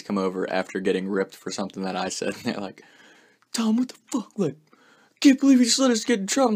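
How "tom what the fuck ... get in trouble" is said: very high, whining friends' impression; whiny buddies. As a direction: mimic the buddies whining at you. Buddies complaining to Tom.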